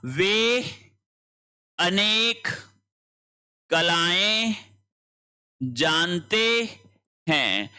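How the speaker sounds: each word set apart with long pauses, slowly; slow dictation. Shown as a demonstration. Teacher spelling out the corrected sentence for students, slow and spaced. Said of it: "देखो, बहुवचन हो गया ना".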